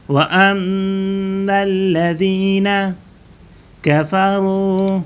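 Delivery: melodic recitation, drawn out; Quran recitation. Acted. Teacher recites the verse for pupils, melodic chant.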